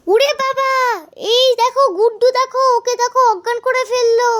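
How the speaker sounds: high, loud alarmed cry. Acted. Alarmed at fainted friend.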